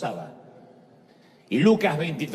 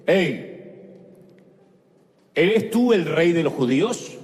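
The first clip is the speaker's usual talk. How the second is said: loud, commanding question; Roman governor interrogating; 4 dB louder than usual. Acted. Pilate questions the accused Jesus, lightly acted loud interrogation. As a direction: demand an answer from the accused. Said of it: "el pequeño hombrecito que representa el poder".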